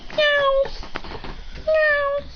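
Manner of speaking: high meowing like a kitten; high cat meows from the box. Acted. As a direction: mew high like a small cat.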